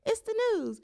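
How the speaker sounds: high, bright mock announcer voice; playful imitation of a perky news intro; mock newscaster intro. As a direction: hype it up like a cheesy weather host. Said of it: "They told me to say".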